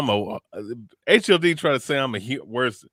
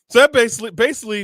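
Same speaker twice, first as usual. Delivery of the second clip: higher, louder burst of outrage; worked up; 9 semitones higher, 8 dB louder, much faster than usual. Real, not acted. Host reacting to a rival's tweet, loud outrage. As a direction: jump in hot and loud.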